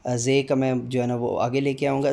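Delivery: normal talking voice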